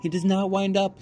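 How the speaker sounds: put-on high, firm little girl; high primly correcting girl voice. A narrator's reading. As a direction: set the boys straight primly. Abilene answers the boys.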